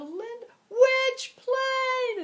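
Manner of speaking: high, loud, drawn-out call across the tarmac. Retold or imitated. Woman running late calls back to Linda, loud and high. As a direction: shout back over the engines, slow and high.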